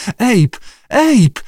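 high, rising panicked call of his name; frightened cry. A narrator's reading. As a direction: scream for help from the water. Lee screams for Abe in fright. (przerażony, wysoki krzyk)

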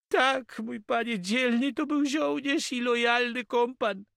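very high-pitched character voice; grieving Catalan. A narrator's reading. Catalan answers his master about the dead soldier, lamenting.

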